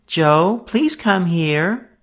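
high, slow plaintive call. A narrator's reading. Sister pleading with brother from library, plaintive. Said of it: "slightest possible plaintive note".

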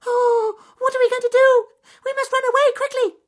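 very high, loud, panicky squeak; frightened mouse. A narrator's reading. Francis Field Mouse telling the rabbits about the fire, high frightened squeak. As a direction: rush it out, terrified.

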